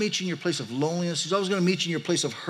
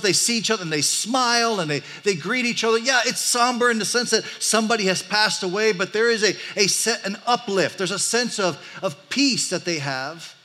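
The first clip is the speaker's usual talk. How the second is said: higher, louder, brighter-toned telling; lively sermon; 5 semitones higher, 5 dB louder than usual. Acted. Pastor describes a joyful funeral to the congregation, animated. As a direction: paint the joyful funeral for the church.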